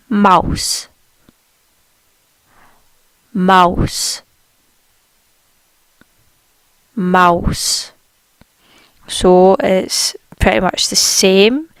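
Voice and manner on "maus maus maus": Maus with a long open ow sound; German Maus. Shown as a demonstration. Teacher modelling Maus for learners, careful German pronunciation. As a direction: stretch the ow in Maus. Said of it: "the word for mouse in German is".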